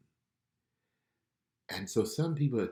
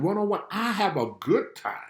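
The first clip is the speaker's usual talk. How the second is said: ordinary talk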